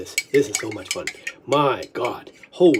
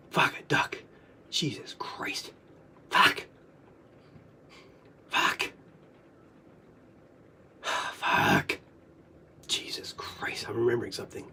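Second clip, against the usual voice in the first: very soft, breath-only curses; whispered swearing; 11 semitones lower, 12 dB quieter than usual. Real, not acted. Overwhelmed as memory returns, whispering curses. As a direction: swear slowly under the breath.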